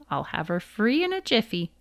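ordinary delivery

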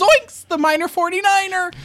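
high, loud cartoon exclaim. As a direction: yelp it like Shaggy.

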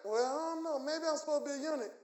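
soft, wavering mock reluctance; imitating someone with no conviction; doubtful answer. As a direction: mumble it as if unsure. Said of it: "You better say it without doubt".

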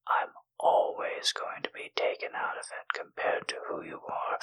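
soft close intimate whisper. Acted. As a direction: breathe every word into the mic.

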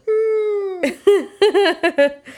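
helpless teary cackle; high wheezing laugh. Real, not acted. Wife cracking up at the assassin joke, teary wheezing laugh. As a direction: laugh until it squeaks.

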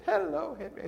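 high-pitched imitation of a cheery neighbor; mimicked cheery hello from next door. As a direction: chirp the greeting way up high. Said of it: "this cheery voice".

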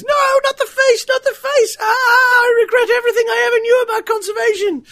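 high squeaky panicked screaming; squeaky panicky sidekick. Pretend. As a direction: shriek like someone being gored. Voicing panicking victims.

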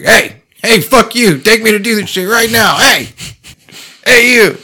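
high, loud, pushy dog yelling at its owner; demanding dog voice. Retold or imitated. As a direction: bark out the demands like a bossy wolf. Voicing his wolves demanding.

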